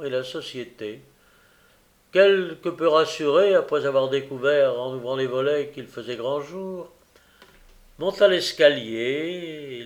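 usual speaking voice

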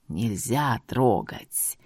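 very low, slow grating voice; creaky djinn voice. A narrator's reading. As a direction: grind it out, ghostly. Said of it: "скрипучим голосом".

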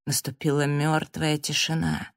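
plain reading voice

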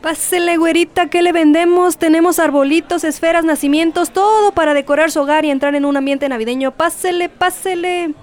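high, fast, hawking stall patter; market vendor barker. Acted. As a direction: call customers into your stall. Vendor calling in Christmas market.

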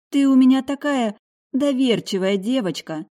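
mother fussing over her daughter; fond mother. A narrator's reading. Mother voiced by narrator.

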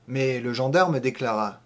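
ordinary delivery

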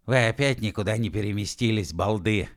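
plain reading voice

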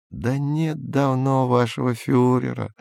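ordinary delivery, nothing marked

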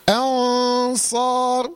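أنصار slowly, the hidden ن in a full heavy nasal before the ص; heavy ghunna. Shown as a demonstration. Teacher shows the heavy ghunna in أنصار. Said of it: "إذا كان حرف الإخفاء مفخم".

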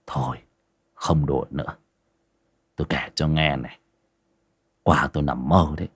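tired master calling off the joke. Acted. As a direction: settle down and start the tale, softly.

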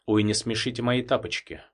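ordinary delivery

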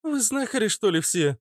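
put-on high-pitched girlish amazement; surprised. A narrator's reading. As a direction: ask in astonishment, voice lifted high. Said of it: "удивилась Фитилёк". (удивлённо, высоким голосом)

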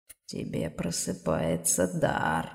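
very low, slow, dark voice; low otherworldly demon rumble; deep demon voice. Acted. Demon voice in her head.

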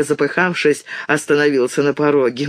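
ordinary delivery, nothing marked

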